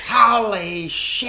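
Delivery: high, loud, stretched-out Kiwi dad holler; imitated scream. Dad being shown the rock, imitated astonished scream. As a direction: scream it slowly, as a stunned dad. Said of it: "And just screamed".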